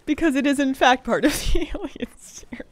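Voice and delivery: breaking into laughter; laughing. Real, not acted. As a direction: laugh through the words. Laughing at herself.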